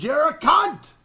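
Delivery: high, loud yelled insult; retold shouted insult. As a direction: hurl the insult. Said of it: "He goes".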